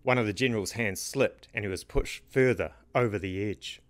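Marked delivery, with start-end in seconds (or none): none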